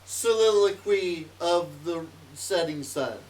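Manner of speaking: loud, slow proclamation starting high; mock-grand declamation. Player gliding down at sunset, loud mock-grand declamation. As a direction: declaim it slowly like a grand title.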